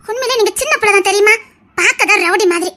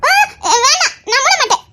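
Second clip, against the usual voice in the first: giggling refusal; 7 semitones higher than usual. Acted. Playfully refusing his mischief.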